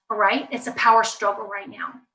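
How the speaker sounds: ordinary talk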